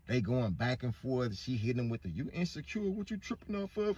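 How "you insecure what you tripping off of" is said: mock girlfriend. Woman's defiant retort.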